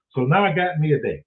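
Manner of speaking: ordinary delivery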